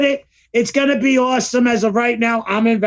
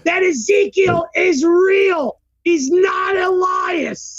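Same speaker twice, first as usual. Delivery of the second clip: higher, shouted conspiracy rant; mock outraged rant; 6 semitones higher than usual. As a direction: yell it like a convinced conspiracy nut. Ranting conspiracy about wrestlers.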